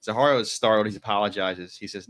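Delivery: ordinary talk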